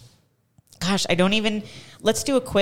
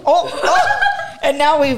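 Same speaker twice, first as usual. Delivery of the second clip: laughing; 10 semitones higher, 4 dB louder, much slower than usual. Real, not acted. Seat breaks, laughing.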